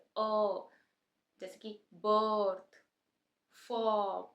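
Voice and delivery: अ, बर्थ, फॉर्म held long and slow; long er sound. Shown as a demonstration. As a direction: stretch the vowel in बर्थ and फॉर्म, r silent. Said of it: "इस sound को long pronounce करते हैं".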